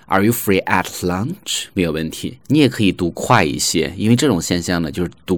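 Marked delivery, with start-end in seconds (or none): 0.0-1.6 "at" with full vowel and t; slow question, each sound of "at" kept; full form. Shown as a demonstration. Teacher saying this is fine too, slow unreduced "at". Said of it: "你也可以发成at".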